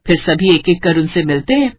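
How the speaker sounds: plain reading voice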